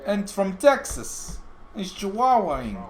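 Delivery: hammed-up Cuban accent after cracking up. Streamer retries a requested Cuban accent after laughing, hammed-up. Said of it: "Do a Cuban accent".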